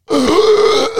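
loud real burp; a sudden high burp. Real, not acted. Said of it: "Excuse me, that was a burp".

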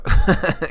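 sudden high, loud laugh; burst of laughter. Real, not acted. Host cracking up at music too cool for her show.

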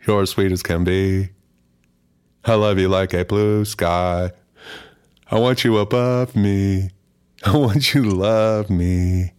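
wanting to be loved, lightly voiced; love-struck song character. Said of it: "he's just like".

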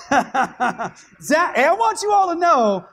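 high, loud, laughing announcement; laughing as you tell the whole room. Real, not acted. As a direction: tease a colleague through laughter. Teasing Zach over long sermon.

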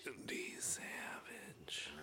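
slow, whispered wrestler rasp; Randy Savage. Acted. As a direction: stretch it out in a hushed wrestler voice. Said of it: "The Macho Man".

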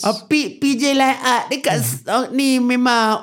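put-on Kelantanese local's lilt; Kelantanese accent. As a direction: give directions in a Kelantanese accent. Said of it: "Kelantanese guy".

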